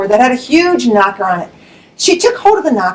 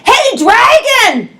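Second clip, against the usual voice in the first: very high, slow call; a princess shouting into the dragon's ear; 12 semitones higher, much slower than usual. Acted. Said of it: "She shouted as loud as she could".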